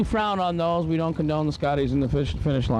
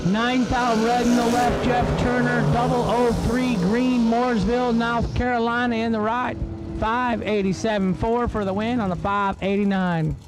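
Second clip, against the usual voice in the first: race announcer; 8 semitones higher than usual. Acted. Announcer to the grandstands, calling each run's numbers in an announcer read. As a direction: drag strip PA announcer calling times. Calls pairing and result.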